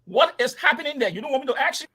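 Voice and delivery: ordinary talk, nothing marked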